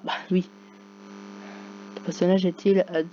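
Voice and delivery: ordinary talk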